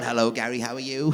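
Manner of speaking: softened robot monotone; toned-down robot. Acted. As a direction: greet someone as a half-hearted robot. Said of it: "I'll just tone it down a little bit".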